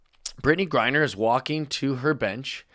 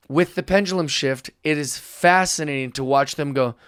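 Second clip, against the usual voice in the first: usual speaking voice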